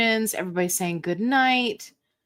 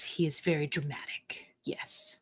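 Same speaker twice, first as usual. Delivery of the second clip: hushed joking aside; whispered aside; 4 semitones lower, 6 dB quieter than usual. Real, not acted. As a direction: whisper it behind your hand. Joking aside about dramatic husband.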